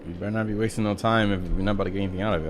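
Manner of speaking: normal talking voice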